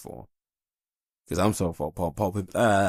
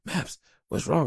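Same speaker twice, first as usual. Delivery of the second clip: worried wife; 9 semitones higher than usual. Retold or imitated. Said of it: "my wife is tripping out like".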